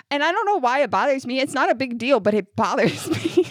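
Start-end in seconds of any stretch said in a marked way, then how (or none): none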